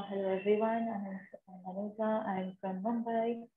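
slow, very soft mumble under the breath; speaking under breath. Shown as a demonstration. Teacher demos a muffled intro to the class, speaking under her breath. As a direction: mumble it so they strain to hear. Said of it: "I am speaking under my breath".

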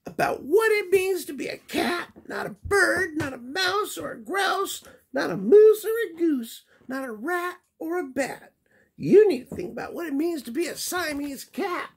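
very high lecturing mother voice; scolding mama. Acted. Mama cat lecturing Skippy on being a cat. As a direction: keep listing the animals in the high mom voice.

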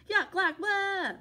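high, loud gagging yell; dad yelling yuck. A narrator's reading. Dad sprayed in the face, loud gagging yell. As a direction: choke on the spray. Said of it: "He yelled".

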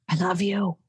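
unvoiced whisper, soft and close. Real, not acted. Whispering to her co-host at the close. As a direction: breathe it to him as a secret.

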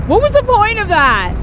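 high, disbelieving question; baffled outrage; incredulous. Real, not acted. Incredulous after death.

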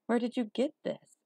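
put-on high-pitched curious boy; curious question. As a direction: ask it with lifted, wondering voice. Boy curious about the bear.

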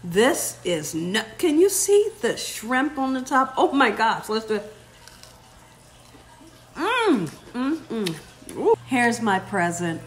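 normal talking voice

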